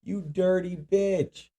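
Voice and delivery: slow, drawn-out mock insult; mock disgust. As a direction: sneer it out slowly. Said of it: "I'm like".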